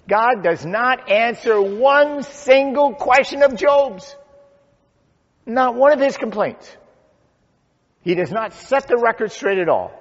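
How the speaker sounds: high, loud, hammered declaration; emphatic preaching. Real, not acted. Preacher stresses to congregation that God owes no answers, emphatic preaching. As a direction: drive every word home from the pulpit. Preacher drives the point home.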